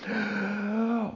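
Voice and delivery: high, soft strained intake; held-breath gasp. Acted. Pupil obeying the no-breathing rule, gasping. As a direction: suck air in quietly and hold. Class holding its breath, replayed.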